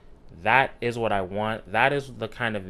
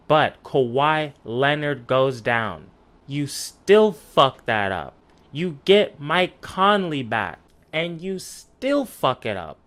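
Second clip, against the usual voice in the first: real exasperated rant, drawn-out stressed words; pissed off; 3 semitones higher, 4 dB louder than usual. Real, not acted. Pissed fan berating the Jazz and a pundit, slow emphatic outrage. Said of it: "because I'm pissed".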